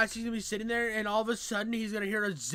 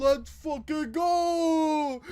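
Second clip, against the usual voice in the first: loud, high hype yell; hyped cheer; 6 semitones higher, 7 dB louder, much slower than usual. Real, not acted. Host cheering on the texts, hyped yell. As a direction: holler it, stretching out the last word.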